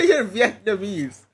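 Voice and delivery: laughing repeat of the joke. Real, not acted. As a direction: laugh through the words. Laughing echo of friend's joke.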